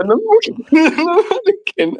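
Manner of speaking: laughing and squeaking through the words, high. Real, not acted.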